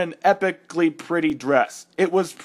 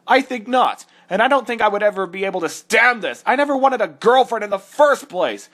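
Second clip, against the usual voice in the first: outraged rant; 3 semitones higher, faster than usual. Acted. Character ranting at the fanfic.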